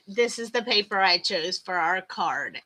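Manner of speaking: ordinary talk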